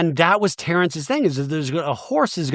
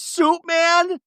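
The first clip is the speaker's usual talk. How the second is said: much higher, hammed-up confused squeak; imitating Angie; 14 semitones higher than usual. Angie asking the group, high mock confusion. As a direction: squeak it like a baffled friend. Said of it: "Angie goes".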